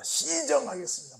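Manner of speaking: 군인 말투로 "시정하겠습니다"; soldier's reply. Retold or imitated. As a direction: snap it out like a recruit. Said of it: "뭐라고 말하면 시정하겠습니다".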